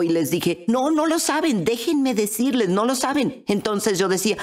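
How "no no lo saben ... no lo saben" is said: high, urgent contradiction; reenacted eager protest, pitched up; insistent. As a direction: insist they really don't know. Insisting against family's calm reply.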